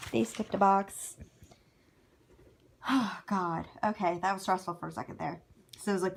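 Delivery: usual speaking voice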